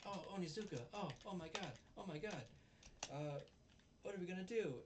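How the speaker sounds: high, mock-panicked female character voice; Fuyutsuki. Fuyutsuki worries to Onizuka, mock alarm. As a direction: fret in a high put-on voice.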